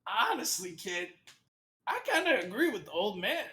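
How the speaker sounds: put-on, swooping high character voice; demon companion. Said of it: "he, he just goes".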